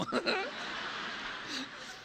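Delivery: airy unvoiced laugh; breathy laugh. Real, not acted. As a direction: let a soft laugh escape. Laugh after joke.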